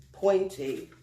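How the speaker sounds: plain reading voice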